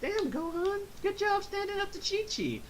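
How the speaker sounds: acted high, impressed reaction; high-pitched amazement. Viewer praising Gohan for standing up to his mother, high-pitched mock amazement. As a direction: squeak out mock admiration. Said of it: "And I'm like".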